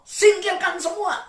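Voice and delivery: high, loud command; commanding preaching. Acted. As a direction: order them to let it go. Preacher answers prayer. (perintah khotbah yang keras dan tinggi)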